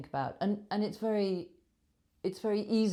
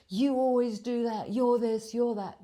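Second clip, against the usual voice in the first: higher, louder finger-pointing outburst; raised, accusatory voice; accusing retort; 7 semitones higher, 6 dB louder than usual. Acted. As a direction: hurl it back as an accusation. Acted accusation thrown back at apologiser.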